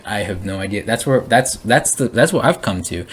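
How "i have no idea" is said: quieter, letting go; soft surrender. Pretend. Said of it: "to just surrender and be like".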